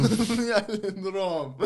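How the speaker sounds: high, slow, laughing mock Jamaican accent. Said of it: "that Jamaican accent".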